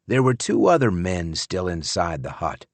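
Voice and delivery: usual speaking voice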